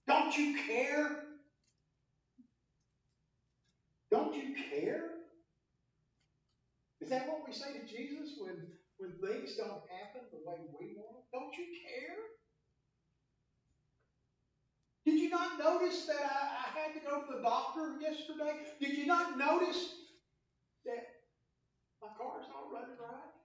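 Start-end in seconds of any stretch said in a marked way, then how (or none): 0.1-5.1 loud, high, desperate cry for help; panicked disciples shouting; shouting in fear. Acted. 11.3-23.3 whiny complaint. Pretend. Mock complaint to Jesus.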